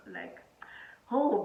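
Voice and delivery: ordinary talk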